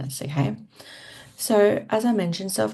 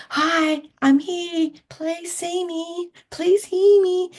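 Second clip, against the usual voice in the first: put-on much higher, slow needy child voice calling out; childlike plea; 11 semitones higher, 4 dB louder, slower than usual. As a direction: plead to be seen like a small child, drawn out.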